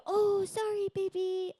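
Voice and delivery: put-on high, loud sing-song "oh sorry baby"; cooing parent. Parent comforting a baby after a bump, high cooing. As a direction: coo like a parent fussing over a baby. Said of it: "and then go".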